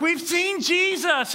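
high, slow, thrilled announcement; excited news. Retold or imitated. Disciples telling Thomas they saw Jesus, excited. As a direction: burst out with the big news.

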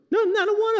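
accidental high squeak; voice cracking high. Real, not acted. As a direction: voice jumps way up on the words. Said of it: "Sorry, got a little high there".

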